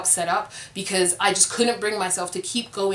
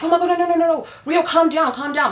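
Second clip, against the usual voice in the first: much higher and louder, hammed-up flustered cast members; mock panicked pleading; 9 semitones higher, 5 dB louder than usual. Castmates urging Rio to calm down, hammed-up panic. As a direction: flap around begging her to calm down.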